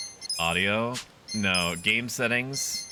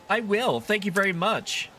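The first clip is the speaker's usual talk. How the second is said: much higher, bright thanks; grateful character voice; 9 semitones higher than usual. Acted. Character thanks the helpful stranger, high put-on voice. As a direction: answer sweetly in a put-on voice. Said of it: "when I was doing the voice acting".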